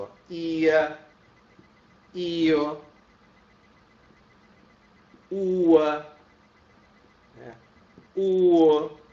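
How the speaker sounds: ia, io, ua, uo called out loud one by one; teacher listing hiatus endings; vowel endings. Shown as a demonstration. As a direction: chant the endings ia, io, ua, uo. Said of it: "isso é clássico".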